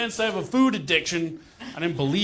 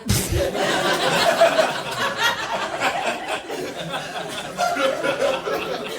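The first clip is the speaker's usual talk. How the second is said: crowd laughter; 7 semitones higher, 4 dB quieter than usual. Real, not acted. Audience laughs at flub.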